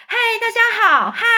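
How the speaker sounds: put-on high loud child greeting; high excited boy's hello; boy calling out. A narrator's reading. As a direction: wave and call like a happy boy. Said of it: "一边哈哈大笑，一边挥手叫喊".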